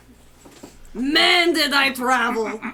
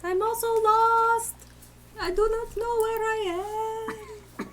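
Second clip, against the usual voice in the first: small creature voice; 7 semitones higher than usual. Acted. Tiny Bagby character voice.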